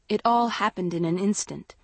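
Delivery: ordinary delivery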